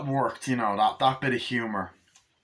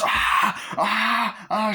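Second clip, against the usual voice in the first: high mock shower-scene screams; hammed-up victim shrieks; imitated screams; 8 semitones higher, 4 dB louder, much slower than usual. Film fan acting out the shower attack, mock screams.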